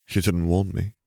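ordinary delivery

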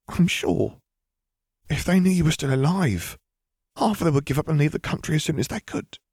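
high, insistent character voice; anxious high-pitched assurance; Pansy, nervous young witch. A narrator's reading. Narrator plays frightened Pansy.

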